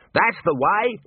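high-pitched cheering call; high, rousing encouragement; crowd cry. A narrator's reading. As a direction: egg someone on from the crowd. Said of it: "plenty of cries of".

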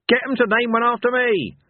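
high, loud yell as he leaps aboard; old hero yelling a farewell as the chair speeds off. A narrator's reading. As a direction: fling the line back over your shoulder. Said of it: "yelled Cohen".

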